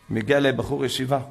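ordinary talk, nothing marked

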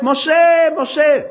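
loud shout, high and strong; God calling out. Acted. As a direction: call Moses from far above, full voice. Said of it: "צועק".

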